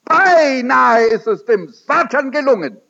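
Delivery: high, loud outcry about Satan; the preacher warns the congregation of Satan in a loud, high outcry; fervent preaching. A narrator's reading. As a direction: cry it out from the pulpit. Preaching at full force.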